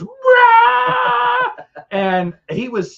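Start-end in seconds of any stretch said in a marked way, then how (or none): 0.0-1.5 very high, loud roaring cheer; crowd roar. Retold or imitated. The whole camp crowd erupting, a loud roaring cheer. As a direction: roar like a crowd going wild. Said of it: "and everyone goes".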